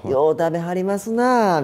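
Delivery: high, lilting Kyoto politeness; snide Kyoto dialect. Acted. Kyoto host hinting a guest should leave, snidely polite. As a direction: veil the dig in sweet Kyoto speech. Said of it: "京都の人のなんか、あの、ちょっと嫌味な感じ".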